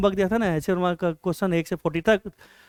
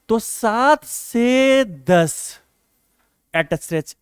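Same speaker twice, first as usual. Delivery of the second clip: very slow, each word stretched; drawn-out dictation; 2 semitones higher, much slower than usual. Real, not acted. Teacher writing out a study slot for students, slow drawn-out dictation.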